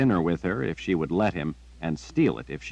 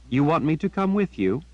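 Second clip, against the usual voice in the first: lightly voiced girl, a touch higher; Jillian, guessing; 8 semitones higher than usual. A narrator's reading. Girl asking her raven if she should follow. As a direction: ask the bird if it wants you along.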